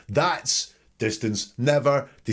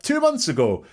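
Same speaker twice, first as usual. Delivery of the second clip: high, quick answer; 13 semitones higher than usual. Shown as a demonstration. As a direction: fire it back right away. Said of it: "you'll spot it when I say it now".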